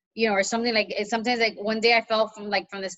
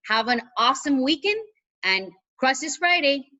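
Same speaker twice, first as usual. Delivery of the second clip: upbeat sign-off; 5 semitones higher, 4 dB louder than usual. Shown as a demonstration. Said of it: "so genuine and natural".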